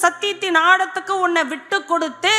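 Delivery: high, driving sermon voice; exhorting the congregation at a high pitch; forceful preaching. Real, not acted. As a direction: preach it urgently, phrase on phrase.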